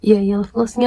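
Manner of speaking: ordinary talk, nothing marked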